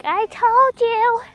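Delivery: high, drawn-out gloating imitation; teasing retold gloat; mock I-told-you-so. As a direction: rub it in, sing-song and slow. Retold gloating after being right.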